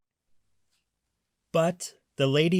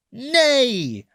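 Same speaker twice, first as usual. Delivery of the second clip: high, loud neigh; whinny out loud like a horse; 11 semitones higher, 6 dB louder than usual. A narrator's reading. Reading to children, a loud high horse neigh. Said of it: "said the horse".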